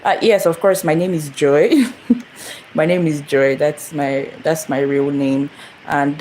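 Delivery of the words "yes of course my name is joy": ordinary talk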